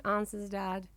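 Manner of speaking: usual speaking voice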